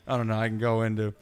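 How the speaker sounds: normal talking voice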